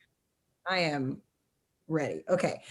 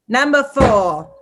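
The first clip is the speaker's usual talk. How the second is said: slow, loud, drawn-out announcement; annoying voice; 5 dB louder, slower than usual. Pretend. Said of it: "read it in this annoying voice".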